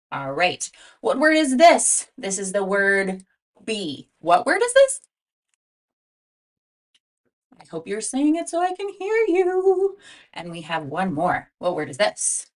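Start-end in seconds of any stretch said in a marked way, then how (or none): 1.0-2.1 eager prompting. Shown as a demonstration. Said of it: "teacher prompting class". 4.2-10.0 high, loud quiz call; high, sing-song prompt to the class; eager prompting. Shown as a demonstration. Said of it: "teacher prompting class".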